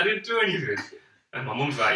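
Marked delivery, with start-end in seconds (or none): 0.0-0.8 high retold squeal of denial; protesting innocence. Kid denying it to his parents, mock indignant. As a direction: imitate a wrongly blamed kid.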